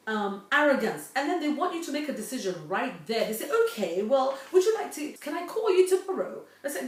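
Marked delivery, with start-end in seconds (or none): 3.6-6.4 pushy caller. Retold or imitated. Pushy caller voiced in story.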